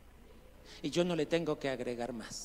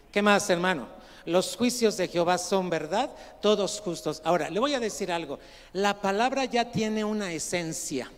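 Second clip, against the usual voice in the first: loud, projected pulpit voice; emphatic and loud; forceful scripture preaching; 4 semitones higher, 8 dB louder than usual. Real, not acted. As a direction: proclaim the verse to the whole room.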